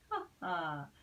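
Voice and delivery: a light read-aloud laugh; bug's chuckle. Acted. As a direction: chuckle it for the little bug. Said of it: "laughed the bug".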